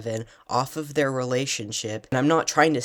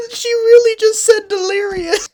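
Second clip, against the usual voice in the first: laughing hard; 11 dB louder than usual. Real, not acted. Laughing at search result.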